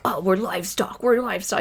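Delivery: understated grumbling imitation of anger; mock angry. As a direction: imitate an angry complaint without raising volume. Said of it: "He's always really angry about it".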